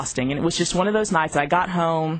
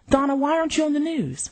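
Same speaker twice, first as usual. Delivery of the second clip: higher, demanding mother on the phone; Mama's voice; 9 semitones higher than usual. Retold or imitated. Mother phones daughter asking why she's off air, higher and demanding. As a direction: play the puzzled mother, pitched up.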